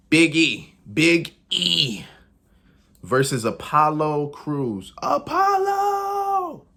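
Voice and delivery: drawn-out, high ring-announcer call of the names. Acted. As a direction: belt out the names like an arena introduction. Ring announcer calling out wrestlers.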